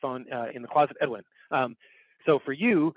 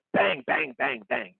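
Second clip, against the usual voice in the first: high, punchy bang bang; mock banging; 11 semitones higher, slower than usual. Shown as a demonstration. Teacher imitating the level meter slamming zero. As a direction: hit each bang like a meter clipping. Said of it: "just slamming against zero".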